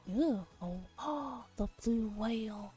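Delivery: ordinary delivery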